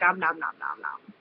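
playful munching om nom sounds; shark chomping noise. Pretend. Playing her shark username, a mock chomp. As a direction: chomp like a hungry shark, lightly. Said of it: "My username is Shark Attack".

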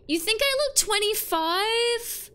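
high, loud, drawn-out disbelief; mock-offended outcry. Real, not acted. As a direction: squeal it as if scandalized by the compliment. Mock offence at being called twenty-five.

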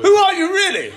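high, loud laughing question; laughing challenge. Real, not acted. Friend unmasking a fake guest, loud laughing question. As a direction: burst out laughing as you demand it. Pretend identity reveal banter.

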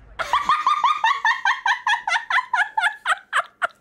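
bursting into high-pitched laughter. Real, not acted. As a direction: cackle at the joke. Streamer laughs at game line.

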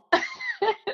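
a bright, high burst of laughter. Real, not acted. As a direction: laugh freely at your own joke. Laughing at her own joke.

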